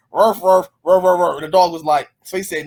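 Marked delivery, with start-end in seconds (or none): 0.1-1.3 quick run of spoken barks; imitated barking. Man barking a message to his dog, imitated barking. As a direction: mimic a dog with human woofs. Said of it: "he barked to him and said".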